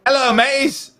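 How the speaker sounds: high, hammed-up pirate greeting with a UK and Australian mix; mock pirate accent. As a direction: greet them like a cartoon pirate, in a muddled British-Australian accent. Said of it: "A pirate".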